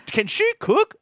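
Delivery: put-on high, loud old lady squeal. Pitching his Ragu ad to the execs in a high, loud old lady squeal. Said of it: "a bunch of old ladies".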